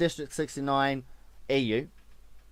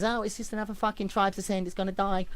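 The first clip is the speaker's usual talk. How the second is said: high, softer mimicking moan; mocked naysayers; 4 semitones higher, 4 dB quieter than usual. Streamer imitating naysayers he hates, mocking whine. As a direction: put on a dismissive doomsayer's voice. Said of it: "when you get these naysayers".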